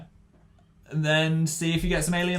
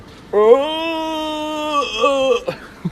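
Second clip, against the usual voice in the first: shrieking laugh; 13 semitones higher, 4 dB louder than usual. Real, not acted. Hysterical laugh at trick.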